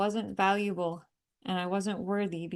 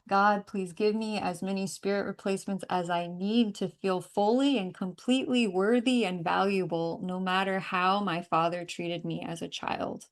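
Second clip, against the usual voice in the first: firm, louder prayer voice, asking God to clear; earnest prayer; 5 dB louder than usual. Acted. Healer praying aloud over the client, firm and earnest. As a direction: pray it out with conviction.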